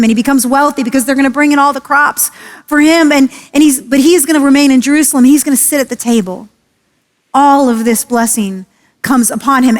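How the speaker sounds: normal talking voice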